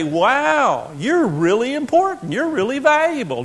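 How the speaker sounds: mock admiration. Mock praise for bailed-out child.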